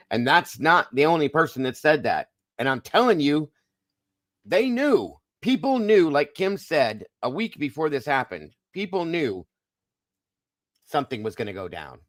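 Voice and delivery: high, loud and fervent; emphatic insistence. Real, not acted. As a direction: drive the point home hard. Insisting people knew beforehand.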